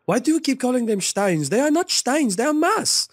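put-on German accent. Imitating German drinking friends in a high German accent. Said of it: "I'll say it as best as I can in their accent".

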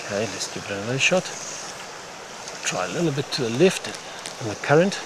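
ordinary delivery

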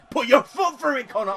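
high, loud urging shout. Real, not acted. Gamer yelling at his player to shoot, loud urging shout. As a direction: yell at Conor to hit it.

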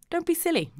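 brushing it off in a sudden high voice; high, breezy dismissal; high-pitched. Acted. Archie brushes off guest's protest.

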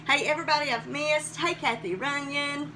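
ordinary delivery

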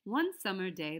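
usual speaking voice

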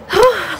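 big high exhale; breathless sigh. Real, not acted. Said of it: "I'm, like, out of breath".

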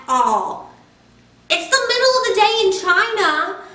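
lightly voiced pleading pigeon, wheedling and bargaining; a cheeky pigeon stalling bedtime. Pigeon begs the child to let him stay up, lightly pleading.

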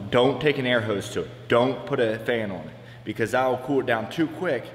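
usual speaking voice

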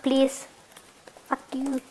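usual speaking voice

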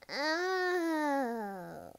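long high "oh" swooping up then falling; drawn-out high wail; long cry. Acted. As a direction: cry out long and loud, pitch arching high. Startled cry in acted scene.

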